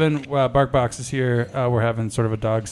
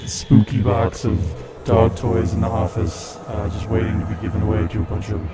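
spooky voice. Pretend. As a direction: announce it like a possessed host. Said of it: "we're coming back to normal here".

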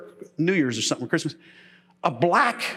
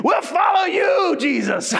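eager crowd shout; 9 semitones higher, slower than usual. Retold or imitated. The fed crowd vowing to follow Jesus, high slow shout. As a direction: belt out the promise like fans swept away. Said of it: "they got done eating".

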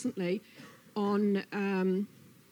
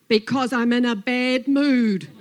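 loud, emphatic snake outburst; cross Snake; 3 semitones higher, 12 dB louder than usual. Acted. Snake snaps at startled Lizard, loudly cross. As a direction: blast out the admission, fed up. Snake finally admits bad mood.

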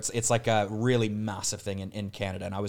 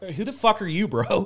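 mock unfriendly Canadian; 7 semitones higher than usual. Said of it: "everybody in Canada's like".

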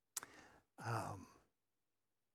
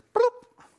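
imitated baby passing out; 11 dB louder than usual. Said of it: "he just goes".

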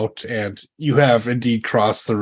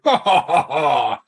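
high-pitched, acted belly laugh; hearty mock laughter; 5 semitones higher than usual. Sir Giles laughs after dropping his rival in the river, laughing. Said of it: "and starts laughing".

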